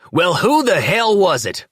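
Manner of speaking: high, loud furious demand; angry shout. A narrator's reading. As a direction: yell it in his face. Danny yelling at sheriff.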